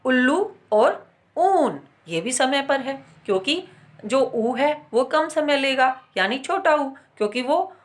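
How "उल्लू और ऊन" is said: उल्लू short, ऊन drawn out; slow demo of उल्लू और ऊन; short and long u. Shown as a demonstration. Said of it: "इनका मूल रूप देखिए".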